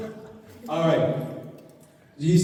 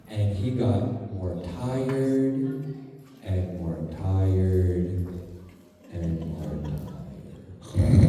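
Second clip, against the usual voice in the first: very slow, falling lower and softer; tired fade; 7 dB quieter, much slower than usual. Shown as a demonstration. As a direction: let each tired drag lower like dozing off. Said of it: "You see how I'm doing it".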